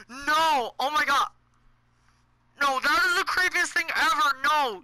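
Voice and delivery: freaked-out gamer cry; creeped out. Real, not acted. Creeped out by game figure.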